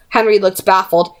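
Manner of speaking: ordinary delivery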